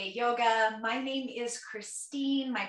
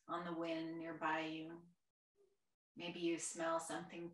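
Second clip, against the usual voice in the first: guided meditation; 3 semitones lower, 6 dB quieter than usual. Real, not acted. Yoga teacher guiding a chair class through their senses, soft meditation voice. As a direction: gently guide them inward, quieter and lower than chat.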